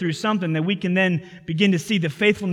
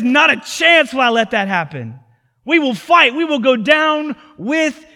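loud, higher fighting protest; defiant shout; 5 semitones higher, 6 dB louder than usual. Retold or imitated. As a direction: refuse him at full voice. Peter's defiant protest to Jesus.

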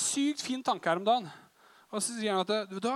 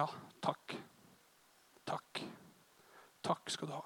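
whispered thanks; 6 semitones lower, 14 dB quieter, slower than usual. Retold or imitated. Worship, hands raised, quiet thanks.

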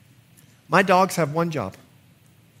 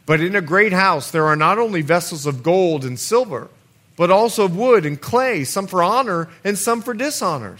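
louder, higher scripture reading; preaching scripture; 4 semitones higher, 5 dB louder than usual. Acted. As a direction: proclaim the verse to the church.

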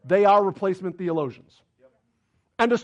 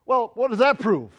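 normal talking voice

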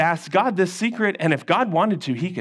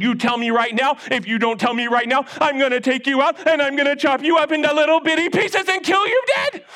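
angry king threat; 6 semitones higher than usual. Acted. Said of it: "Then he got really mad".

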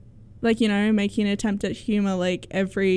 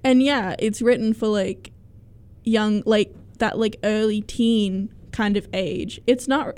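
usual speaking voice

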